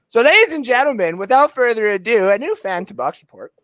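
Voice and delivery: high, dramatic introduction with long pauses; hammed-up showman's reveal, slowing down; mock announcer. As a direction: build up a surprise guest like an emcee. Announces a new fan guest.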